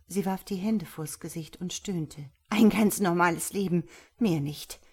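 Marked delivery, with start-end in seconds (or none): none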